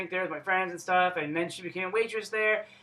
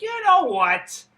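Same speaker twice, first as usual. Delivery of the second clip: higher, louder, slow and put-on; mock dramatic inner voice; 10 semitones higher, 7 dB louder, slower than usual. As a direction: ham up a big resolve.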